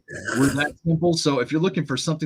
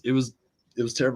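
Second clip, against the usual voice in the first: ordinary delivery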